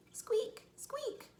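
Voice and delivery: tiny, very high squeaks; high-pitched mouse voice. Acted.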